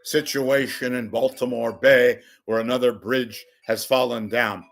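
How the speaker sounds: loud newscast intro; news anchor. Pretend. Host introducing a pretend news bulletin, loud anchor tone. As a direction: announce it like breaking news. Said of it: "mock news anchor".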